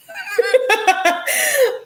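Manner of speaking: big squealing laugh at her own joke; loud high laugh. Real, not acted. Streamer laughing at her own crude joke, high squeal. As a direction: burst out in a high cackle.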